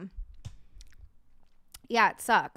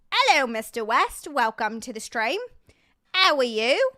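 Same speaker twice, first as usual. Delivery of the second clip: playful put-on hello voice, louder with swooping pitch; mock-grand welcome with swooping tune; silly put-on greeting; 5 dB louder than usual. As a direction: greet them in a silly affected voice. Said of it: "I don't know why I did that".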